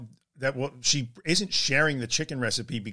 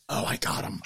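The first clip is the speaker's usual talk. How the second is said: unvoiced sly whisper; gleeful whisper; 4 dB quieter than usual. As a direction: whisper it like you caught him out. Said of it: "somebody right now is like".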